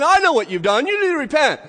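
high, loud accusation; acted prophet's shout; shouting accuser. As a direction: call out the stranger as they approach. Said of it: "he's shouting".

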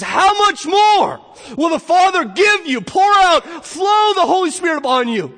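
high, full-force, drawn-out proclamation; soaring, slow, thunderous exclamation; exultant preaching shout. Real, not acted. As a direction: shout the promise at the top of your range. Preacher exclaims over the verse.

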